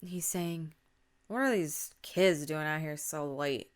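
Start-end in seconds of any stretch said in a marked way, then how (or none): 1.3-3.7 put-on drunk old man's grumble; lightly voiced muttering old man; mumbling old man. A narrator's reading. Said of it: "mumbling something".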